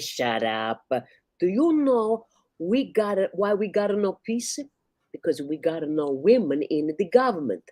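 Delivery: Italian wife lecturing her husband; Italian accent. Acted. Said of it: "like a loud Italian".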